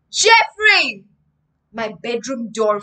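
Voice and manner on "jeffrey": loud, high call of a name; dad calling out. A narrator's reading. Dad bursts in on his son, loud high call. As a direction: call out sharply for your son.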